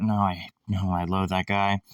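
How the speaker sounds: usual speaking voice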